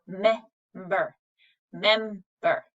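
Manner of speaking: member said slowly, the short eh stressed; slow demo of short E. Shown as a demonstration. Teacher models the short E sound, slowly. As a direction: draw out member to show the eh. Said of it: "say the eh sound".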